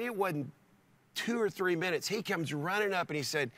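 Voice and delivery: normal talking voice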